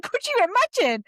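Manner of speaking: high, loud, quick replay of an outburst; re-enacted shout. Apologising to the guys for shouting, re-enacts the shout. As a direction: imitate your own excited shout. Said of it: "Just shouted. I was like".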